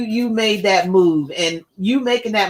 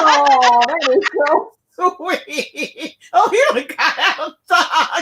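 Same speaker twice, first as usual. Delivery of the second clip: laughing delight; 6 semitones higher, slower than usual. Real, not acted. Moved by child's story, laughing.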